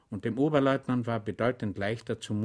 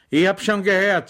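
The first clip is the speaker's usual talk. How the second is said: ordinary delivery, nothing marked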